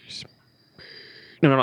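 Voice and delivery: ordinary talk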